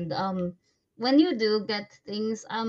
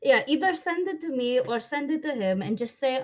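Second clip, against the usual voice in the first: ordinary talk, nothing marked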